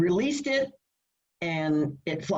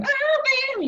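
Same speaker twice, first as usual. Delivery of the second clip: mock dismay. Retold dismay about high singing.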